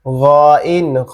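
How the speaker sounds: throaty gha sound then name kha; teacher demonstrates the letter from the throat; Arabic letter sound demo. Shown as a demonstration. Teacher shows students the throat letter, slow and clear demo. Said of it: "কণ্ঠনালীর শেষ ভাগ হচ্ছে".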